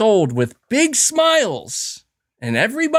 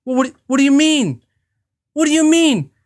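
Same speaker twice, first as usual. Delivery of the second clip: mock-baffled believer, higher and louder; a higher, louder, incredulous what do you mean; Kool-Aid believer; 5 semitones higher, 3 dB louder than usual. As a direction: play a dumbfounded true believer. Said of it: "And they're like".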